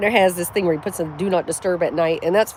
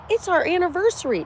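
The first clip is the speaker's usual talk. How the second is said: lightly imitated husband, pitched up high; mimicked husband; 10 semitones higher than usual. Retelling what the creepy guy said to her, high mimicking voice. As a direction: quote him in a higher mocking voice. Mimics a man's excuse, scornfully.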